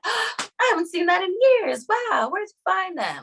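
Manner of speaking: very high-pitched wonder after a gasp; imitated astonished mother. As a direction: mimic Mom marvelling at a long-lost find. Mother amazed by old remedy.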